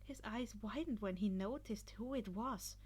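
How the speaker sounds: ordinary delivery, nothing marked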